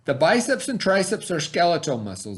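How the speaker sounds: put-on clear, deliberate read-aloud voice for kids; reading to children. Teacher reads the science book to her students, storytime voice.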